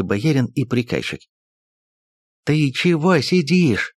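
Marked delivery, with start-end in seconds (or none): 2.5-3.9 higher, slow barked question; barking. Acted. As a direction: bark it at him. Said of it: "рявкнул на Ерофея приказчик".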